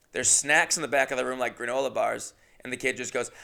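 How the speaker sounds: usual speaking voice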